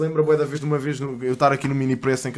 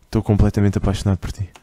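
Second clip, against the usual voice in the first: prank caller; 6 semitones lower than usual. Pretend. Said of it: "autorizam-me a gozar com o C quatro".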